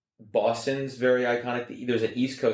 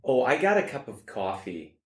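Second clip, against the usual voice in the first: higher-pitched Midwest mom accent; 4 semitones higher than usual. Shown as a demonstration. Doing three accents for others to guess, Midwest. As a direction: put on your mom's Midwestern vowels. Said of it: "my mom's accent".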